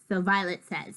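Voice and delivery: plain reading voice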